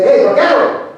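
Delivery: calling out. Acted. Waking a vaquero for his turn to ride, calling out. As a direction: call to a sleeping man.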